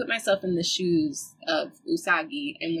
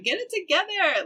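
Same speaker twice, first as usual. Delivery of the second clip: lightly voiced big sister, higher pitch; a higher, mock big-sister telling-off; 12 semitones higher than usual. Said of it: "big sister energy".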